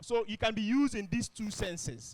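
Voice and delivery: usual speaking voice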